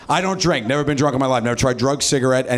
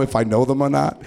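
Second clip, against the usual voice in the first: ordinary delivery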